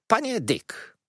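high-pitched address, falling at the end; calling to attention. A narrator's reading. Aunt starting to address Mr. Dick, calling him to attention. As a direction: call him to attention, high then dropping.

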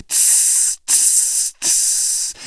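hissing sea creature. A narrator's reading. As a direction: hiss like a newt. Newts hiss at Lee.

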